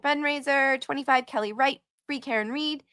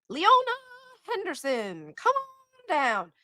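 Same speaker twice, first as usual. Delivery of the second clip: game show host; 12 semitones higher than usual. Pretend. Streamer calls donor to the stage like a host. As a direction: call her up like a prize show host.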